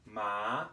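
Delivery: "Ma" começando no meio e subindo; second tone ma. Shown as a demonstration. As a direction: say "Ma" rising from mid-range. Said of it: "starts mid-range and goes up".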